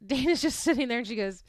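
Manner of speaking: normal talking voice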